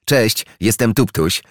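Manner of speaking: wyżej, radośnie wykrzyczane powitanie królika; wesoły okrzyk. A narrator's reading. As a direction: przywitaj się z entuzjazmem, jak skoczny królik. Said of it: "wykrzyknął wesoło królik". (wesoły okrzyk królika na powitanie jeża)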